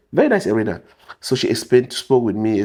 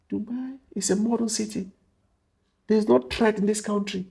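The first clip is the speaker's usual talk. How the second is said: calm reassurance; 6 semitones higher, 8 dB quieter than usual. Retold or imitated. Captain calming him down.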